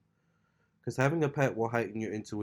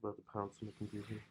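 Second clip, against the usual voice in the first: ordinary talk, nothing marked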